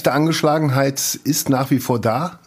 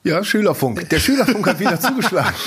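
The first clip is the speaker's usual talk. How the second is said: fast, laughing and higher; 7 semitones higher than usual. Real, not acted. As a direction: giggle through the words. Laughing tease about school radio.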